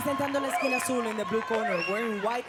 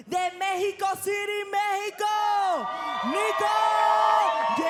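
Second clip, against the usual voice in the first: announcer shout; 9 semitones higher, 6 dB louder than usual. Real, not acted. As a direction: rouse the home crowd. Announcer calls hometown fighter's name.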